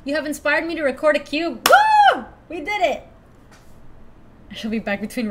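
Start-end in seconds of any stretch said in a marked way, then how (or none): none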